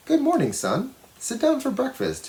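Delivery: a hint of a gentle mom calling her son; mother's voice. A narrator's reading. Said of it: "his mother said".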